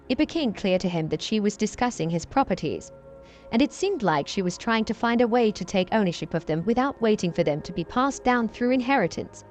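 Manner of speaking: ordinary delivery, nothing marked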